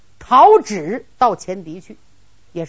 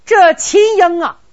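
ordinary delivery

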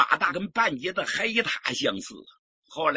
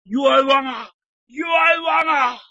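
desperate loud wail of injustice, much higher; crying out; 10 semitones higher, 8 dB louder than usual. Acted. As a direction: shout for mercy as he is dragged off. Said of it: "就喊". (高声喊冤)

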